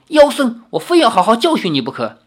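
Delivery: 更高更响的怒斥，后半句加快; 冲着妖僧怒吼. Acted. 李逍遥 furious at the monk. (愤怒地喝斥)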